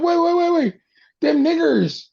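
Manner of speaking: high, loud mock of the slavers' alarm; mocking shout. Mocking the slave traders' reaction.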